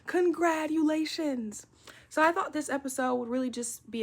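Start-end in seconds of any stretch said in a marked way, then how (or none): 0.1-1.7 loud, high, drawn-out congratulations; big stretched-out shout of congratulations; celebratory cheer. Real, not acted. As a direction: cheer it slowly and loudly. Cheering the graduates.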